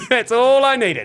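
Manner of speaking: giggling through the punchline; laughing. Real, not acted. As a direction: crack up while repeating it.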